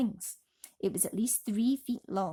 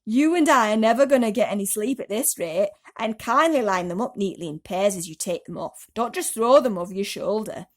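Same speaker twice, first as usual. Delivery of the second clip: impatient centipede; 9 dB louder than usual. A narrator's reading. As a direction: bark it at a dawdling boy. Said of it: "the centipede said".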